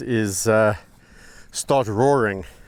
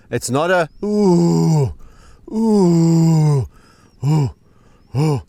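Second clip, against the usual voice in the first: long, drawn-out, louder roar hoots; slowed lion roar impression; 6 semitones higher, 6 dB louder, much slower than usual. Keeper showing how the lion really roars, slow mock roar. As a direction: stretch each roar call out slowly and loudly. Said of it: "He goes".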